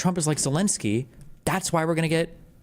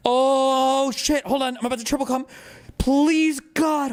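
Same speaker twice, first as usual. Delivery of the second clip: hammed-up anticipation; 10 semitones higher, 3 dB louder than usual. Streamer praying the lecturer says it, hammed-up anticipation. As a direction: beg in a high, overexcited cry. Mock frenzy awaiting awaited claim.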